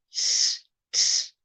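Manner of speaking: ts said as a short airy hiss; teacher modelling an airy T; airy ts demo. Shown as a demonstration. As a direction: breathe the ts out, no voice. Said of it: "there's a ts".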